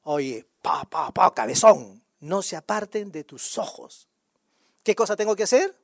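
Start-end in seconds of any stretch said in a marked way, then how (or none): 0.0-4.1 God's retold reply, chiding. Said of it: "Y Dios me dice".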